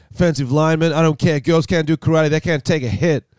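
hammed-up macho teacher scoffing; sexist karate sensei. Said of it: "That's a pretty good impression".

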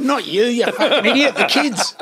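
Chopper voice. Retold or imitated. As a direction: bark it like Chopper at his girlfriend, hammed up. Quoting Chopper scene.

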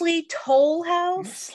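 high, slow put-on quizzical voice; drawn-out high mock question; imitating Phoebe. Friend quoting Phoebe about cookies, high slow mock voice.

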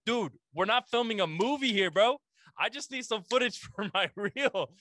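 exasperated protest. Retold or imitated. Actor retelling his protest to the cameraman, exasperated.

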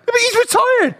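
high, slow exasperated reply; heated retort. Real, not acted. Arguing football with a mate, high and heated. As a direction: throw it back at him.

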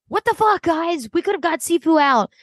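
high, half-mocking "what the fuck" at the girls; exasperated outburst. Imagining Dee yelling at the other girls, exasperated. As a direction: play Dee finally snapping at her allies.

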